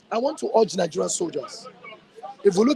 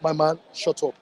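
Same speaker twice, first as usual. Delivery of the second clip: normal talking voice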